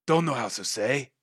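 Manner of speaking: lightly voiced lizard guide; a hint of lizardfolk voice; lizardfolk character. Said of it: "He just goes".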